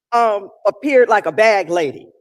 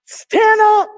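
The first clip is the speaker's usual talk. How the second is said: rallying shout; 10 semitones higher, 8 dB louder than usual. Acted. Rallying call in sermon.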